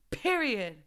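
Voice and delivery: usual speaking voice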